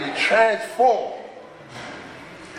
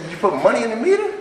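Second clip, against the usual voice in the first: sponsor's question; 5 semitones higher, 3 dB louder, faster than usual. Retold or imitated. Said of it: "He said".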